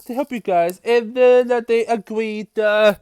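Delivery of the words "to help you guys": much higher, strained pleading voice; squeaky pleading ninja voice; voicing Kai. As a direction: plead high and urgent like a desperate hero. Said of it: "Kai is trying to tell them".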